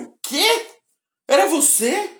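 high-pitched astonished question. Retold or imitated. Retelling their reply to someone who spotted them, astonished.

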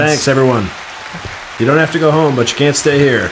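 loud, high closing-time call; hammed-up last call shout; bartender last call. Mock bar closing announcement.

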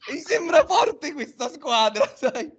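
high, slow, laughing mock praise; fake neutral fan. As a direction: feign admiration through laughter. Imitates Riccardo feigning neutrality. (finto tifoso neutrale)

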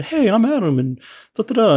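high, eager mock hello; mock cheery greeting. His first naive post to fans, in a high mock-cheery voice.

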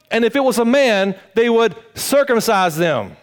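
high, loud pulpit preaching; preaching at full force. Acted. Pastor preaching to his congregation, loud and high. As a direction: drive the message home to the congregation.

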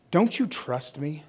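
soft, slow tender question; quietly asked, unhurried; gentle question. Acted. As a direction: voice Jesus softly reassuring his followers. Voicing Jesus to disciples.

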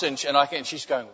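usual speaking voice

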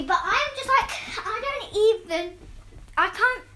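ordinary delivery

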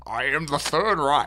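slow, put-on Scottish accent; hammed-up Scottish movie-star delivery; Sean Connery. Friend joking about an action Hitler who keeps his Scottish accent. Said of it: "just keep his regular accent".

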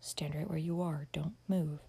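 hushed, firm order; soft, compelling command; commanding whisper. A narrator's reading. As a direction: whisper it so she must obey. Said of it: "whispered to Amy in a tone that compelled obedience".

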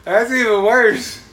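high, loud, slow laughing cry; laughing disbelief. Real, not acted. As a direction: crack up at a friend's fail. Laughing reaction to friend's fail.

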